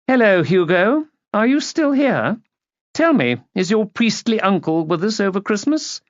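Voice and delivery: high-pitched imperious aunt; grand, condescending questioning; haughty society lady. A narrator's reading. Lady greeting a lingering relative, haughty and high.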